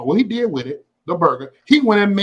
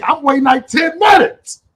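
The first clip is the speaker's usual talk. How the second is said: exasperated; 8 semitones higher, 5 dB louder than usual. Retold or imitated. Complaining about wait.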